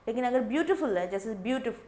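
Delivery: ordinary talk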